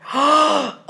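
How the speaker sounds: high, sudden intake of breath; delighted gasp. Real, not acted. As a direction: gasp as if struck by the name. Delighted at hearing the actor named.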